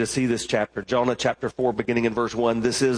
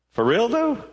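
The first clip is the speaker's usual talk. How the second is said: incredulous slang question; 9 semitones higher than usual. Acted. God asking Jonah in disbelief. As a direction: ask it like you can't believe it. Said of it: "it could have been".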